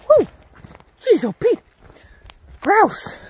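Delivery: loud startled yelp; startled shout. Real, not acted. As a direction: jump at a sudden grouse. Startled by a grouse.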